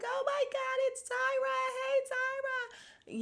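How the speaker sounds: high-pitched mock fan squeal; squealed fan greeting, played up; imitating excited fans. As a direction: gush like a starstruck fan, stretched out. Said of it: "they would be like".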